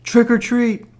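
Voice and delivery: put-on loud, very high child's call. A narrator's reading. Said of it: "He said it a little louder".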